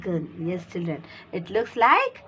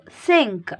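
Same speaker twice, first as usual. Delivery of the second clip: normal talking voice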